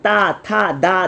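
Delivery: ता था दा said slowly with the tongue at the teeth; dental syllables demo. Shown as a demonstration. Teacher showing dental sounds to students, slow and careful. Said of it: "दंत है".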